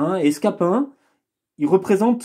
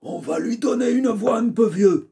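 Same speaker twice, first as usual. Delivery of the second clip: put-on higher, quavering old man; 5 semitones higher than usual. Shown as a demonstration. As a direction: speak like a frail old man. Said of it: "une voix de vieux".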